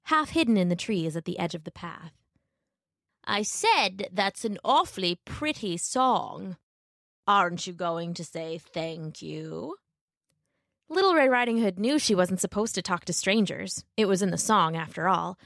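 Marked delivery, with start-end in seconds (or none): 3.2-9.8 slow, sly wolf voice. Acted. Wolf coaxing Little Red on the path, slow and sly.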